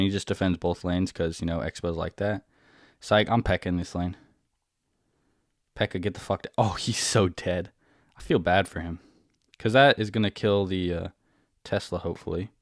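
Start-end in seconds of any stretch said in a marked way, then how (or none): none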